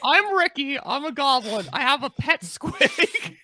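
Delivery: high, loud goblin introduction. Acted. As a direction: puff up proudly as a little goblin.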